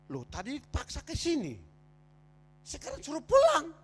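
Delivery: protes heran dengan nada melonjak; protes yang diperagakan. Retold or imitated. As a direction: naikkan nada tajam seperti suami yang kesal, diperagakan. Memprotes ajakan istri untuk pulang. (protes kesal bernada tinggi)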